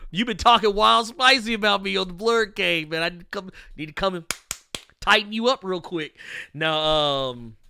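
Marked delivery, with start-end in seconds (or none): 0.1-6.2 louder, mock-tough playful threat; Donny Cates threat. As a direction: tease him like a friendly bully. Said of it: "he'll be like".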